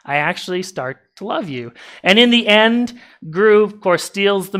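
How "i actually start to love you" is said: softer, lower and gentle; tender confession. Retold or imitated. Villain admits love to the girls, soft and tender. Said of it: "and he's just like".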